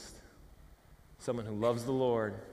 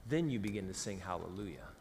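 ordinary delivery

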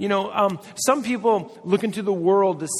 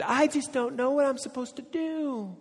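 higher put-on voice of a helpless friend; whiny indecisive friend; 9 semitones higher, 3 dB quieter than usual. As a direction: whine it like someone who can't decide.

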